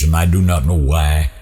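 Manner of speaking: usual speaking voice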